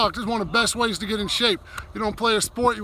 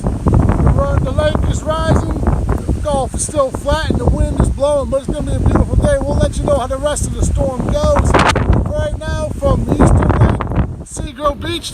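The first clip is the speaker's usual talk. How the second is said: loud over wind; 4 semitones higher, 8 dB louder than usual. Real, not acted. Storm update over wind.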